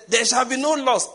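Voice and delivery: loud, high cry of alarmed prayer; retold shouted declaration. Woman praying aloud in a plane, retold shout. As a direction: shout it out like a frantic passenger. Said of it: "The moment he shouted".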